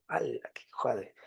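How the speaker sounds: breathy under-the-breath whisper; whispered aside. Real, not acted. Teacher to students about a girl's ultimatum, whispered aside. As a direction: mutter it as a secret aside.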